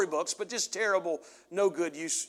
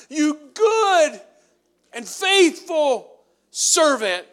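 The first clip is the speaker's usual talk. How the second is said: slow, high, loud proclamation of well done; drawn out like a king welcoming home a servant; joyful Lord's praise; 10 semitones higher, 14 dB louder, much slower than usual. Acted. God praising faithful servant.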